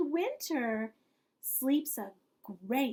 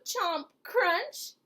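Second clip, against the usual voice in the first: plain reading voice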